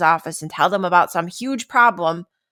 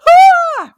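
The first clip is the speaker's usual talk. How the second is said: sudden shrill high panic scream, much louder; a burst of alarm as bad news lands; panicked scream; 12 dB louder than usual. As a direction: stress response shrieking Ah at the news. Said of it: "my stress response is immediately like".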